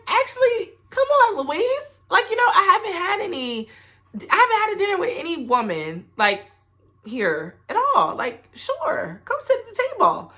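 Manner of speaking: very high, retold eager invitation; high-pitched welcoming reply. Traveller inviting a lone diner to dinner, high-pitched acted voice. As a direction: pitch it way up, inviting her over.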